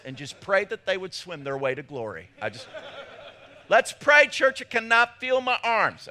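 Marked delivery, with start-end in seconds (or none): 3.7-6.1 mock strained call. Pastor retells losing his arms baptizing.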